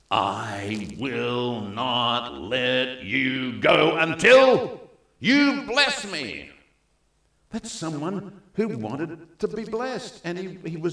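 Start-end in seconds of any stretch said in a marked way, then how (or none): 0.1-6.5 slow, drawn-out and rising to a loud cry; determined Jacob. Acted. Jacob refusing to release the angel, slow and loud. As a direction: hold on and refuse to let go.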